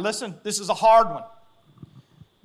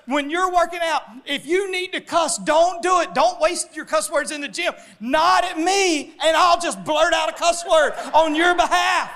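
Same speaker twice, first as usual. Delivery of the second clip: loud, higher, half-laughing quote; laughing retold advice; 10 semitones higher, 7 dB louder than usual. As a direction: shout his pal's offer through laughter. Friend's gym advice, laughing.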